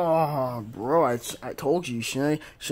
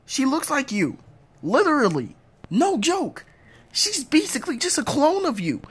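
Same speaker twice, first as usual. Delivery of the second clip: pitched-up pleading insistence; high insisting; 10 semitones higher than usual. Acted. Parent insisting daughter looks like spouse, high. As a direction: push it up high.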